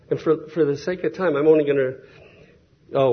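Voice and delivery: normal talking voice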